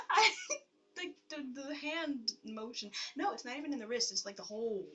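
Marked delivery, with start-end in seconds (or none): none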